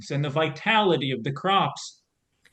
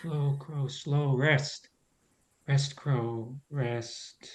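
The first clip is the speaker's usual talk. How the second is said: lower, softer, tender lull; 3 semitones lower, 7 dB quieter, slower than usual. Acted. Scarecrow lulling the dying Crow to rest, soft and low. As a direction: ease her into final rest.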